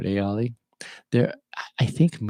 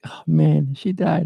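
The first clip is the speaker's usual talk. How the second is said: high, loud mock dismay from the daughter; imitating upset daughter; 8 semitones higher, 5 dB louder, slower than usual. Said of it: "she was really upset".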